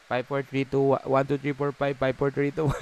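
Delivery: hyped countdown like a live sale; live seller countdown. Acted.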